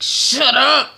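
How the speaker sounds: high, loud acted outburst; yelled command. Troy to the town crier, yelled command. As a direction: cut someone off with a sudden yell.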